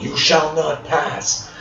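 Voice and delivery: slow, high-pitched dramatic wizard command; theatrical, drawn-out defiance; Gandalf. Retold or imitated. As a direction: stretch out each word like a hammed-up wizard. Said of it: "like Gandalf".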